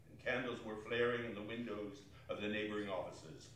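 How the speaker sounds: low, soft fireside narration; hushed storytelling. A narrator's reading. Narrator setting the cold Christmas Eve scene, hushed. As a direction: draw listeners in quietly.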